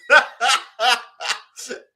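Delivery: loud burst of laughter; real mocking laughter. Real, not acted. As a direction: burst out laughing at the absurd claim. Laughs at the boxer's street claim.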